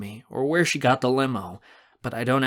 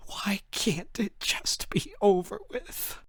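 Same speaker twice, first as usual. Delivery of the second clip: slow, high whispered cry; sobbing despair; 9 semitones higher, 4 dB quieter, slower than usual. A narrator's reading. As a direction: let the plea collapse into a tearful whisper. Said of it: "in front of me and sob loudly".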